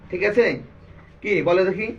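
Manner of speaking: ordinary talk, nothing marked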